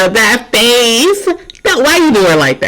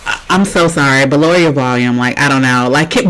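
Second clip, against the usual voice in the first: ordinary talk, nothing marked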